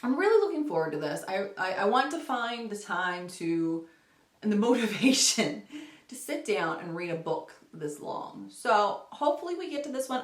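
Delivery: usual speaking voice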